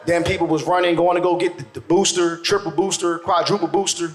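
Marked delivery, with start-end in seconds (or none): none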